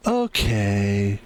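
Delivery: low, drawn-out okay; Office Space guy. Acted. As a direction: answer low and unbothered, as an impression. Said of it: "Like the guy from The Office".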